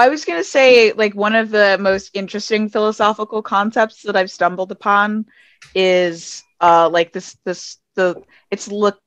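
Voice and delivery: normal talking voice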